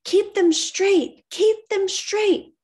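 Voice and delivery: high, loud, slow repeated cry; mother shouting to keep the horses straight, desperate; mother's urgent shout. Acted. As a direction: drive each word out to be heard.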